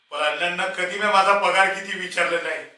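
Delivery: building from loud indignation to high, full shout; anguished shouting. Acted. Servant protests to master about money and leaving home, anguished shout.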